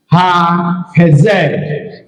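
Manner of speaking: "Ha hezer" drawn out very slowly; slow demonstration. Shown as a demonstration. Teacher repeating the accented Hebrew example, slow demonstration. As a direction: say the example slowly, parts held apart. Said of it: "we have this to be ha hezer".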